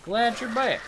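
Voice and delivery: high, loud, delighted greeting; light up as a friend comes back; bright rising happy welcome back; excited welcome. Real, not acted.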